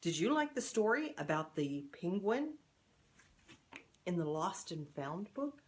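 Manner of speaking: ordinary delivery, nothing marked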